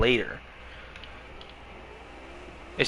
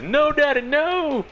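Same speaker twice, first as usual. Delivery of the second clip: high shrieking plea, much higher and louder; mock kid scream; 4 dB louder, slower than usual. What he hears when chat types no, high mock scream. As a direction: squeal like a begging child. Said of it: "that's all I hear is".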